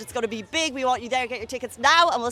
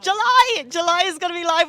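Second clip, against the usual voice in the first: excited; 6 semitones higher, 5 dB louder than usual. Real, not acted. Host hypes upcoming party.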